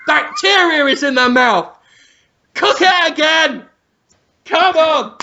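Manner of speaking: high, angry order; hammed-up kitchen shouting; mock chef yell. Acted Ramsay yelling at cooks.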